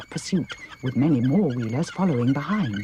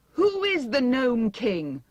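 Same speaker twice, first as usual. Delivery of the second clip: dismayed; 10 semitones higher, slower than usual. A narrator's reading. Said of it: "asked Dorothy in dismay".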